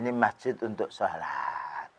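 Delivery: a soft, patient correction to the Bedouin; gentle advice. Retold or imitated. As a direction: advise him tenderly, hushed and slow. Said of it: "dan dinasihati".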